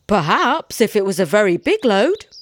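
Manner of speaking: sly, teasing maybe; wily bargainer's reply; crafty. A narrator's reading. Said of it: "answered the woodman craftily".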